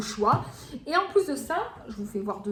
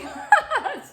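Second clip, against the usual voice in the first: burst of laughter; 13 semitones higher, 6 dB louder than usual. Real, not acted. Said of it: "self-mocking her English".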